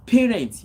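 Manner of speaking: "Parents" called out high and loud; preacher's emphatic "Parents". Real, not acted. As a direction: hit "Parents" hard as a warning.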